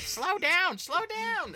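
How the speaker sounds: high, loud retold plea; acted-out frantic shout; mock panicked yell. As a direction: shriek it like an alarmed passenger, in imitation. Retelling mom panicking in speeding car.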